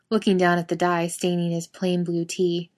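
ordinary delivery, nothing marked

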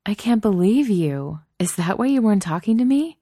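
high, loud incredulous delight; delighted disbelief. Acted. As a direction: tease with amazed delight. Delighted friend reacts to surprise kiss.

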